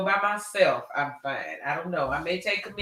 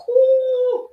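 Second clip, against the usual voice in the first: excited whoop; 8 dB louder than usual. Retold or imitated. Reacting to tight dress.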